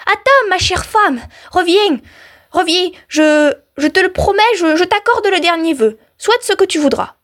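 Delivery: high, urgent pleading; panicked storybook husband, pitched high without shouting; crying out. A narrator's reading. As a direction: call your wife back in alarm. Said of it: "lui cria".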